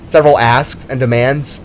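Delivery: plain reading voice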